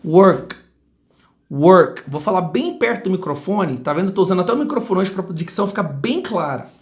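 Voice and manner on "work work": "work" ending cleanly on the k; correct final consonant. Shown as a demonstration. Teacher demonstrating English endings, "work" stopped on the k. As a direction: end "work" on a crisp k, no vowel after it. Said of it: "ela termina realmente na consoante".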